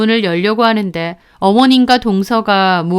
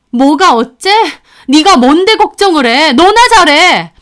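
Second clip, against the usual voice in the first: loud, higher angry retort; raised-voice indignation, building to the end; heated fury; 4 semitones higher, 5 dB louder than usual. Acted. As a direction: lash out at the sister-in-law. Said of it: "하고 열받은 제가".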